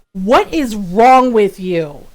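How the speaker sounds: slow, loud shout of disbelief; retold shout. Friend rounds on the coworker in a slow, loud shout. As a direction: stretch every word out in a loud outrage.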